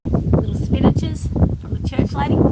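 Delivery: whispery, slow cooing over little plants. Real, not acted. Showing off plants like babies.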